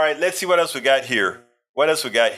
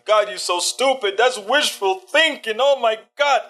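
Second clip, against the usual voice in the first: higher, scornful imitation of a critic; mocking scoff; 8 semitones higher than usual. Viewer scoffing at the host, higher mock-scornful voice. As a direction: sneer it out like a know-it-all viewer. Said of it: "sit down and say".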